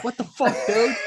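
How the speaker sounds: high, laughing outrage; shocked squeal. Retold or imitated. Jules caught off guard in the trench, high shocked yelp. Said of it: "He's like".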